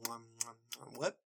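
very soft mouth-full munching; munch the noms quietly with your mouth full; chomping noises. Acted. Background ponies stuffing fritters, munching softly. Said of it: "just chomping on fritters".

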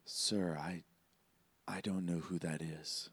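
hushed whisper into an ear. Acted. Assistant leaning in to his boss's ear, whispering. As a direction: whisper it discreetly, with small pauses. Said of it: "he whispers in your ear".

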